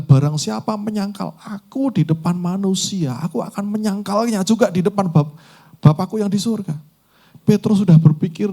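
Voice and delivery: high, loud urgent sermon exhortation; full-force preaching. Acted. Sermon storytelling climax. (khotbah berapi-api, suara tinggi dan keras)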